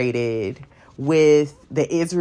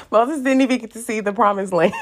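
laughing; 7 semitones higher, faster than usual. Real, not acted. Laughing while telling.

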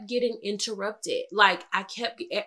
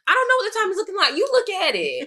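high, loud retold exasperation; aggravated; 9 semitones higher, 4 dB louder than usual. Wife snapping at husband over the time, aggravated. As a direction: fire back, exasperated, pitched up high. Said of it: "I was so aggravated".

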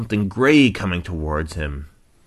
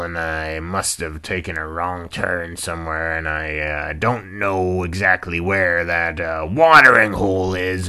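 lightly voiced old rhino, louder, hesitant; Rex; 8 dB louder than usual. Lost old rhino explaining to hippo, mildly put-on halting voice. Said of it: "grumpy old cantankerous rhinoceros".

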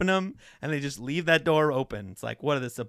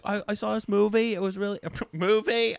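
mock British toddler voice; put-on child voice, slightly higher; 4 semitones higher than usual. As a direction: play a little British kid telling about a film. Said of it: "I'm doing a British toddler".

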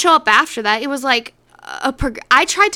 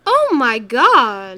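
drawn-out, dismayed outburst; exasperated dismay; 4 dB louder, much slower than usual. Real, not acted. Friend hears the game comes out next year, drawn-out dismay. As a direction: stretch it out in disbelief.

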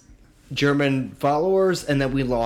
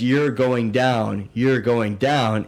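quoting the wrestler; 3 semitones lower, much slower than usual. Retold or imitated. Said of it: "But Ahmed Johnson says".

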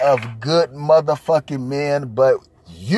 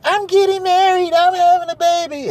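much higher, squealing bride-to-be; mocking high woman's voice; 3 dB louder than usual. Mocking women bragging to each other, high and giddy. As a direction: mimic a giddy woman announcing news. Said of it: "They're like".

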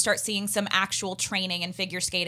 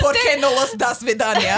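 loud, hammed-up fake Russian accent; badly faked Russian accent, near laughter; mock Russian accent; 2 semitones higher, 6 dB louder than usual. Shown as a demonstration. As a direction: joke in a terrible Russian accent. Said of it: "I'm trying to make fun of their accent".

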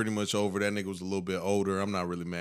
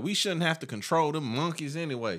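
higher, sneering mock bigot; 8 semitones higher than usual.

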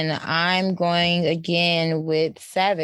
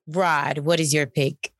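normal talking voice

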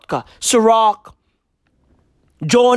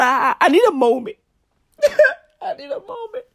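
choked up; 8 semitones higher, much faster than usual. Pretend. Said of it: "I'm getting emotional".

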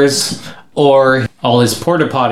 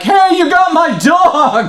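high, drawn-out mock yell; kid calling out; slower than usual. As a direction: call out slowly like an outraged kid. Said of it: "he's like".

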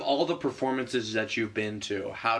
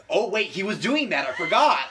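high, loud laughing shout of realization; laughing mock realization; 7 semitones higher, 4 dB louder than usual. Friend imagining the listener's late realization, laughing shout. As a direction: blurt it out through laughter, pretending to catch on late. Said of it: "you'll totally be like".

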